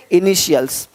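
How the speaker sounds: "इनिशियल्स" as i-ni-shials, falling. Shown as a demonstration. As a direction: say "इनिशियल्स" with sh in the middle. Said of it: "टी का ध्वनि श होता है".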